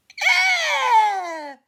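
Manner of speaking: a startled royal squeal. Acted. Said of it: "squealed the king".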